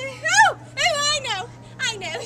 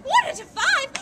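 shocked; 9 semitones higher, 3 dB louder than usual. Acted. Shocked reaction.

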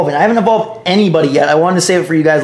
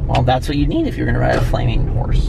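whisper; 4 semitones lower than usual. Real, not acted. Aside about riding the horse.

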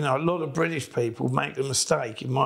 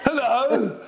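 mock English tourist; 8 semitones higher, much slower than usual. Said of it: "something going".

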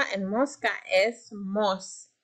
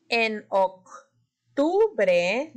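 En octubre drawn out very slowly; stretched emphasis; much slower than usual. Shown as a demonstration. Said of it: "levanten la mano si escuchan".

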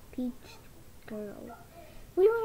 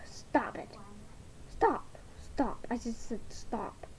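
softer, whispered stop, stop; 10 semitones lower, 10 dB quieter than usual. Acted. Doll whispering stop after the shouting. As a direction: hiss it under the breath.

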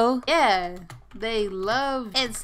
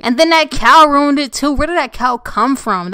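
raised, worked-up complaint; loud exasperation; 4 semitones higher, 9 dB louder than usual. Real, not acted. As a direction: burst out loudly about the nuisance. Complains the cow ruined the video.

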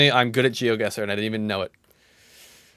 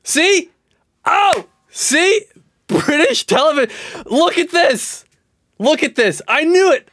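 excited; 6 dB louder, slower than usual. Real, not acted. Vindicated about British show guess.

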